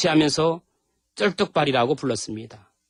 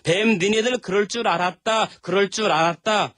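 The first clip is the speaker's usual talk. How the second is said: mocking; 6 semitones higher than usual. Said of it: "조롱하고 희롱하는".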